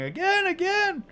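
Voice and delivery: put-on high-pitched, loud children's clamour; little kids begging, high and loud; excited children. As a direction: squeal it like eager little kids. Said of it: "they're all laughing hysterically".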